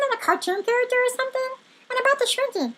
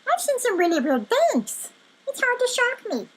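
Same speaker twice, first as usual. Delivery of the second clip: pitched-up, insistent self-defence; defensive outburst; 3 semitones higher than usual. Acted. Rabbit defending himself to an interrupting friend, defensive and insistent. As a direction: rattle off your credentials indignantly.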